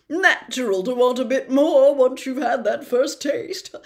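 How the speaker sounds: put-on a preening celebrity patronizing a boy; loud, chuckling, condescending charm; Lockhart voice. Lockhart lecturing Harry.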